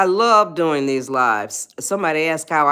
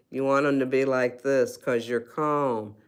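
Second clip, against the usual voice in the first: soft, settled voice, a little lower; calm and soft; 5 semitones lower, 5 dB quieter than usual. Shown as a demonstration. Coach showing the live audience calm neurons, softly. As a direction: let it sit quietly, calm. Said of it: "'cause you're calm".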